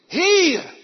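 loud, high sharp call; teacher calling. Retold or imitated. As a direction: summon a pupil across the schoolyard. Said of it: "die je roept".

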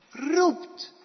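loud, high call. Shown as a demonstration. Preacher shows how clearly God calls, calling out loudly. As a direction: ring the word out so all can hear. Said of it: "dat hoor je goed".

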